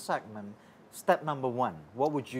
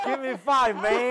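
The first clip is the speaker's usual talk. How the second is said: much higher and louder, bouncing with glee; excited high mock Aussie; 11 semitones higher, 8 dB louder, slower than usual. Real, not acted. Host teasing the guest about her Aussie accent, gleeful high call. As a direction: cheer it like a delighted mate.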